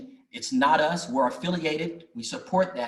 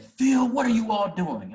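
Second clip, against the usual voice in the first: imitating an upset older man, higher and louder; mock-confront him, voice raised; retold indignant question, pitched up; 9 semitones higher, 5 dB louder than usual. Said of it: "And he was upset".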